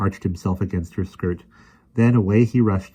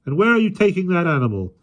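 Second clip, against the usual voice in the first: loud, higher stern questioning; stern demand; 10 semitones higher, 6 dB louder than usual. A narrator's reading. First policeman demanding.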